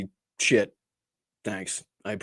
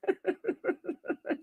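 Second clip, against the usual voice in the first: high, quiet laugh; helpless high giggle at your own mistake; 9 dB quieter than usual. Real, not acted. Host recalling how they found the shirt, quiet laughing.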